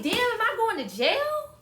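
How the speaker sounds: high, loud alarmed yelp; shocked panic. Retold or imitated. Reading the mystery text, she yelps in shock. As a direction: blurt it out in sudden alarm.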